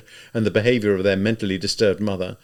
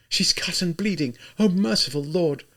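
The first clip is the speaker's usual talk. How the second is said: higher, shocked cry; alarmed exclamation; 8 semitones higher than usual. A narrator's reading. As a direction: gasp it out over her mother. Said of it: "Sonia exclaimed".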